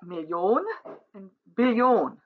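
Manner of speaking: Million and Billion with the final syllable punched: Mil-LION, Bil-LION; stress on last syllable. Shown as a demonstration. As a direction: land hard on the end of Million and Billion. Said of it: "with the last syllable being the louder".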